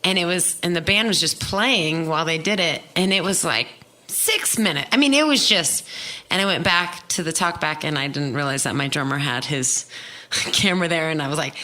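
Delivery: normal talking voice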